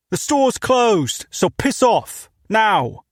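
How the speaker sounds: put-on thick British accent, shouted through the door; angry British shop owner yelling; loud British accent. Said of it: "His British accent was thick and his voice loud".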